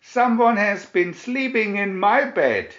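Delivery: Papa Bear booming about his bed; thundering Papa Bear. A narrator's reading. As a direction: thunder it like an angry father bear. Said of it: "he thundered".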